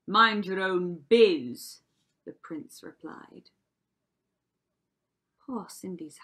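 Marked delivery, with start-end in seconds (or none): 0.1-1.8 loud, slow, forceful put-down; curt rebuke. A narrator's reading. Prince telling Cinderella off, curt rebuke. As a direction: snap it as the rude prince.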